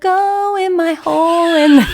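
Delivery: high, stretched-out fake sulking; hammed-up wail of despair; melodramatic mock wail. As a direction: draw it out like a tragic tantrum. Imitating friend's melodramatic sulk.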